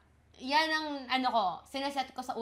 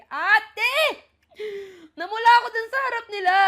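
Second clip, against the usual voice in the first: loud, high, fast excited outburst; giddy shriek at the story; excited squeal; 8 semitones higher, 10 dB louder than usual. Real, not acted. Reader reacts, host excited.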